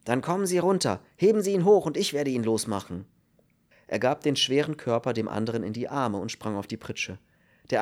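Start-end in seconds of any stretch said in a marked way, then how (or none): 0.0-3.0 raised, impatient voice; urgent command. A narrator's reading. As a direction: take charge in a hurry. Kern impatiently takes over the rescue. (energisch und drängend)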